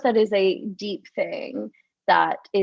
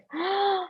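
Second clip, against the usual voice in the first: sharp gasp; 8 semitones higher, 3 dB quieter than usual. Shown as a demonstration. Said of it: "This, like".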